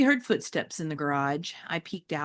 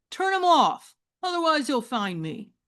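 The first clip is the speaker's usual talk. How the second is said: put-on higher, urgent teen boy; demanding teen; 9 semitones higher, 3 dB louder than usual.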